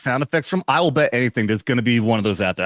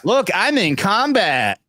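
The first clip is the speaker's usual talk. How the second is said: mocking politician; 7 semitones higher, slower than usual. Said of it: "photo op".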